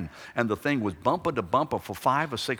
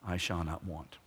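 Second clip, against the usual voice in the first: I shall not want, repeated lower and softer; softer echo; 5 semitones lower, 8 dB quieter than usual. Shown as a demonstration. Preacher echoes the verse softly to the congregation. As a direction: let the repeat sink in quietly. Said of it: "this is a gutsy statement".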